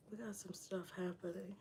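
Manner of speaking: whispered aside while working; voiceless whisper to herself. Real, not acted. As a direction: murmur it under her breath, focused. Muttering to herself mid-styling.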